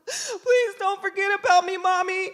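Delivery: put-on high, loud, wailing kid plea; crying child. Son begs mom at the door, mock sobbing. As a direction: sob it out like a melodramatic child. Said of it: "he's crying. He's melting down".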